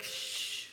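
soft drawn-out "shh"; shush. Acted. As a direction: hush a moviegoer quietly. Shushing someone in a theater.

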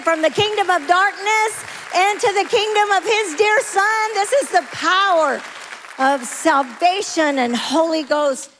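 slow, high, building proclamation; full-force preaching. Real, not acted. Preacher shows church the transformed girls, full-force preaching. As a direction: proclaim it with fervor.

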